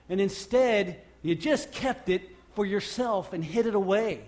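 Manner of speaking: loud indignant question; rebuking. Real, not acted. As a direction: call them out on it. Rebuke of the congregation.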